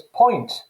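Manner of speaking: "point" said slowly, with a broad Brummie oi vowel; the word "point" shown in a Birmingham accent. Shown as a demonstration. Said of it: "the pronunciation of pie as poi".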